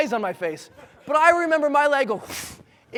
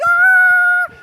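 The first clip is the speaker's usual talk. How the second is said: long, very high-pitched loud scream; 12 dB louder than usual. Retold or imitated. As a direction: shriek it at a high octave. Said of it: "I was screaming so loud".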